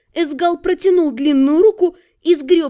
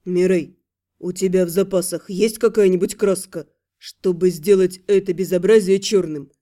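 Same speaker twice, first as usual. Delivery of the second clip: pointedly polite; 7 semitones lower than usual. A narrator's reading. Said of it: "подчёркнуто вежливо поинтересовался".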